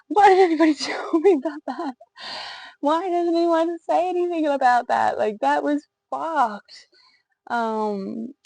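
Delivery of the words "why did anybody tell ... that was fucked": high and loud, laughing through the words; indignant laughter at nobody warning her; laughing outrage. Retold or imitated. Retelling outrage after birth.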